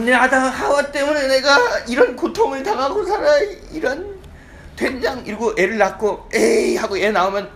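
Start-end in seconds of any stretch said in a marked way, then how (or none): 0.0-5.2 loud, high-pitched wailing complaint; labour-pain outburst. Acted. Woman giving birth blames Adam and Eve, loud pained outburst. Acted woman cursing during childbirth. 6.3-6.8 mock disgust. Acted mother rejecting newborn in disgust.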